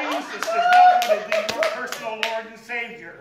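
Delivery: hammed-up preacher in his head; mock preacher voice.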